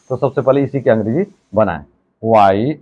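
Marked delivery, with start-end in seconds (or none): none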